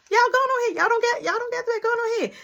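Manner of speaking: high, sing-song mother voice mocking; sarcastic mom. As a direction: shoo the kids off with fake sweetness. Mom dismissing kids sarcastically.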